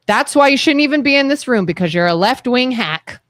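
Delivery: usual speaking voice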